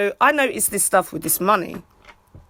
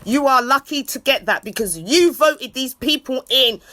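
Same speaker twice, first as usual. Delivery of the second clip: ordinary talk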